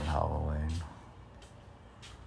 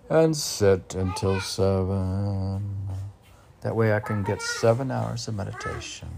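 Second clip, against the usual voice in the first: loud, declaiming poetry reading; poem reading; 2 semitones higher, 7 dB louder than usual. Acted. Reading his meditation poem aloud, declaiming it. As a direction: proclaim the verse to the room.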